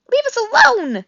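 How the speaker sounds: very high, loud squeak; high frightened weasel squeak. A narrator's reading. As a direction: squeak in terror like a tiny weasel. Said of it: "squeaked Dart".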